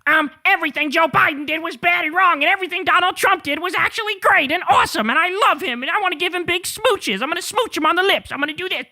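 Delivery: hammed-up fawning politician parody; mocking impression. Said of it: "where you basically say".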